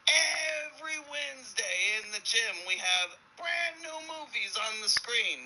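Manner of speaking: slow, high, hollered sales pitch; hammed-up enthusiasm; hyped-up convict. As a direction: draw it out high like a grand announcement. Convict selling prison life in joke.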